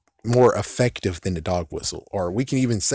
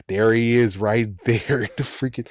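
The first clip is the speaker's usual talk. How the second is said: usual speaking voice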